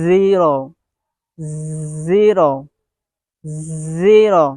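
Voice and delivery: zero said slowly and clearly, each one falling; pronunciation demo. Shown as a demonstration. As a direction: model "zero" with a clean r. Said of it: "you can pronounce like that".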